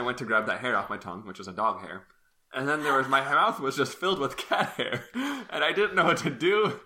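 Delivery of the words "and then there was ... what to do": laughing through the words; giggling while talking. Real, not acted. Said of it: "I'm not laughing at you".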